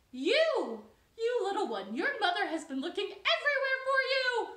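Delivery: very high, loud, fast scolding; frantic bird. Acted. Cheep Cheep the bird scolding the chick, frantic. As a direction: squawk it out urgently. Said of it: "came swooping down and said".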